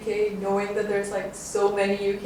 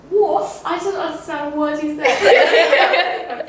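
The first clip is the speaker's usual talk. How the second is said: high, slow, baffled "What?"; confused reality star; 6 semitones higher, 4 dB louder, slower than usual. Retold or imitated. Mimicking a Love Island girl who can't understand Scouse, baffled. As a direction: play the lost girl who can't follow him. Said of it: "she's like".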